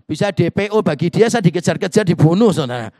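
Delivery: loud comic exaggeration; mock alarm. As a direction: blurt it out loudly as if in danger. Joking alarm at imagined consequence.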